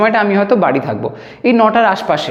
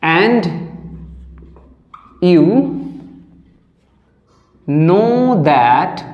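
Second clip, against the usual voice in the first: long pauses between each word; word-by-word dictation; 2 semitones lower, much slower than usual. Shown as a demonstration. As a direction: dictate slowly as if students write. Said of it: "আর তুমি সেটা ভালোভাবেই জানো".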